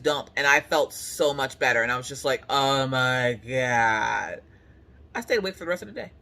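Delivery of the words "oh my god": relieved sigh. Retold or imitated. Relief after bathroom.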